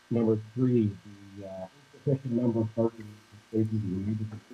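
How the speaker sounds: muffled, cutting out on a bad connection; breaking up like a bad call line. Real, not acted. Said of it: "You sound, you sound muffled".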